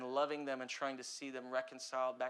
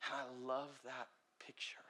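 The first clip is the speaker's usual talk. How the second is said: whisper; 5 dB quieter than usual. Real, not acted. Quiet reflective aside.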